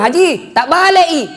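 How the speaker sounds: high mock exclamation; calling out correction. Helper telling Haji the phone is reversed, mock exclaiming. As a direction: call out to correct him, exasperated.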